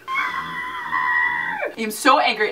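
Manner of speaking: slow, stretched mock angry line; slow theatrical I am so angry; drawn-out mock fury. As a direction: drag every word like a wounded fan.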